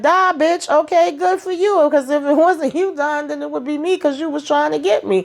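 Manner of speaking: a lightly voiced gloating taunt; mock gloating Maurice. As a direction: sneer it like he's glad. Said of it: "And Maurice is like".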